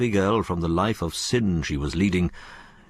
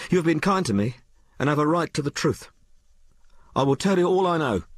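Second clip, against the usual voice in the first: high, fervent declaration; crying out; 9 semitones higher than usual. A narrator's reading. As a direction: pledge it earnestly in a raised pitch. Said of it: "she cried".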